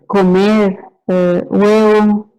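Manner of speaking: slow single words with long gaps; slow, groping words like a struggling patient; lightly acted slow aphasic patient; halting aphasic speech. Shown as a demonstration. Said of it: "y una pausa grande".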